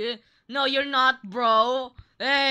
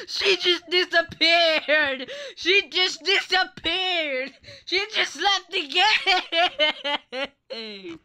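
higher, slow, laughing through it; laughing disbelief; 7 semitones higher, much slower than usual. Real, not acted. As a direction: stretch it out while giggling. Laughing as player vanishes.

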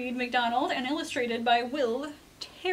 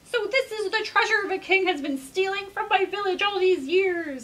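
indignant discovery; 7 semitones higher than usual. Acted. Rooster discovers stolen treasure.

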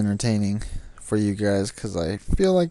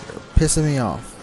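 normal talking voice

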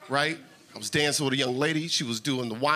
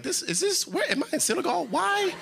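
stunned visitor voice, pitched up high; confused tourist, high; 7 semitones higher than usual. Retold or imitated. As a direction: act bewildered, much higher. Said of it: "you're like".

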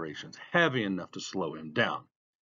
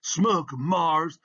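raised, higher-pitched cheer; called cheer; 6 semitones higher, 4 dB louder than usual. A narrator's reading. Onlooker egging on a racer, called out. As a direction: call out encouragement from the crowd. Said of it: "someone else called".

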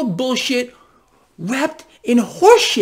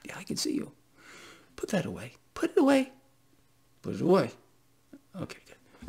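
joking whisper; 4 semitones lower, 12 dB quieter, faster than usual. Pretend. Joking at naked viewers.

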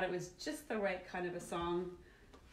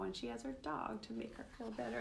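ordinary delivery

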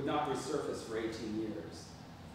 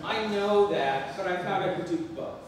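loud sheepish reply; 6 semitones higher, 7 dB louder than usual. Acted. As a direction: stretch it out loudly. Son replies sheepishly.

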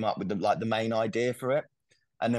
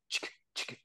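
mock ska guitar; 11 dB quieter than usual. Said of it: "we're not standing there going like".

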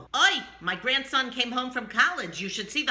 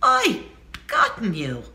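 horrified; 6 semitones lower than usual. Shown as a demonstration.

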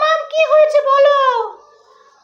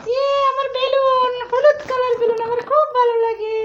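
put-on much higher, a small child begging for the balloon; toddler voice; 9 semitones higher, 3 dB quieter than usual. Mother voicing her toddler asking for the balloon, high childlike plea. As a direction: plead like a little kid asking mama.

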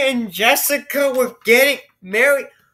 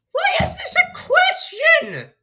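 incredulous shout. Real, not acted. Player baffled by the story's logic, shouting high. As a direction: yell in sheer bafflement.

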